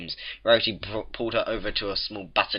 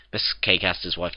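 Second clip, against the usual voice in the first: usual speaking voice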